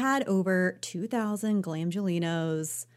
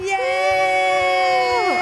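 high loud yay; excited cheer; 6 dB louder than usual. Real, not acted. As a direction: cheer at the top of your voice. Cheering the winner.

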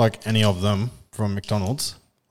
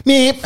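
squeaky loud buzzer meep; high loud meep; 14 semitones higher, 6 dB louder than usual. Acted. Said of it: "That's my buzzer".

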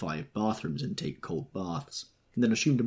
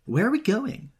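high, louder, raised-voice questions; crying out; 6 dB louder than usual. A narrator's reading. Daisy calling to the men alongside, crying out high. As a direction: call the questions out, pitched way up. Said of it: "she cried".

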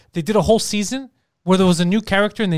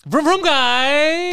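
high, very slow flamboyant call; theatrical camp voice; 8 semitones higher, much slower than usual. Acted. Theater guy accelerates his motorbike, theatrical. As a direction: stretch it out high and stagey. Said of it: "That's a theater voice".

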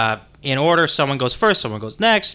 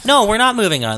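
insistent protest; 8 semitones higher, 6 dB louder, faster than usual. Real, not acted. Insisting on staying on topic.

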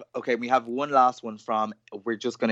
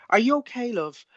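put-on much higher, gentle concerned old lady; 11 semitones higher than usual. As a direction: ask like a worried old dear. Old ladies ask with concern.

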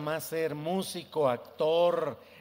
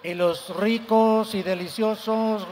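slow sing-song street vendor cry; tamale vendor call; 4 semitones higher, 6 dB louder than usual. Retold or imitated. (pregón de tamalero)

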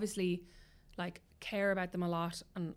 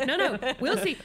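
higher and louder, stubborn refusal; defiant retort; 7 semitones higher, 6 dB louder, slower than usual. Retold or imitated. Stepmother refusing husband's plea to calm down, defiant higher retort. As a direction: dig in your heels and refuse. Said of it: "And I'm like".

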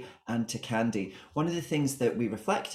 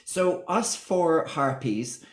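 high, loud, slow camp aside; campy drag queen; 7 semitones higher, 5 dB louder, much slower than usual. Acted. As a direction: drawl it out with camp relish. Mock calling guests harpies.

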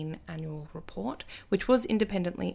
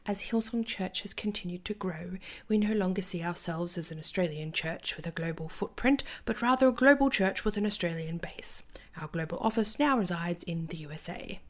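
ordinary talk

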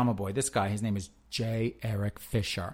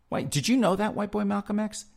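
much higher and louder, hammed-up astonishment; mock surprise; 11 semitones higher, 4 dB louder than usual. Teasing co-host with mock surprise, high and loud. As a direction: act shocked, teasing.

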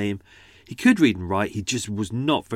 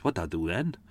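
run-together South Wales phrase, rising at the end; soft South Wales lilt; South Wales accent; 4 semitones lower, 6 dB quieter than usual. Shown as a demonstration. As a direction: say it quickly with a Cardiff lilt. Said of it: "South Wales thing".

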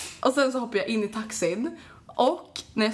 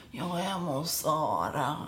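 low, slow, softer slurred answer; drunk mumble; 6 semitones lower, 5 dB quieter, slower than usual. Retold or imitated. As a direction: drag it out like a drunk teen. (låg, långsam, sluddrig fyllröst)

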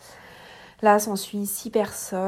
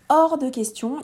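louder, higher flat no; firm refusal; 6 semitones higher, 8 dB louder, slower than usual. Retold or imitated. Woman in labour refusing her husband's hospital plan, firm and louder. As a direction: shut the idea down at once.

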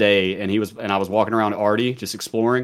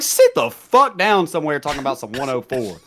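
mock outrage; 7 semitones higher than usual. Mock-heated retort to cellmate.

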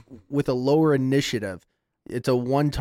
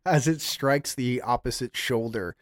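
ordinary talk, nothing marked

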